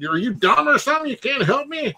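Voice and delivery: hammed-up high drunk customer; angry drunk caller. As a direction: squeak out the caller's exasperation. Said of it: "some drunk guy going".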